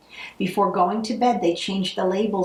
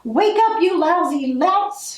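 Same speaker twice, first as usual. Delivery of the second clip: furious shriek; 9 semitones higher, 3 dB louder than usual. Acted. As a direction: shriek at the sleeping louts. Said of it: "she shrieked".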